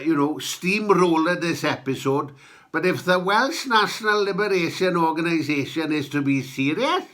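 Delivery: ordinary talk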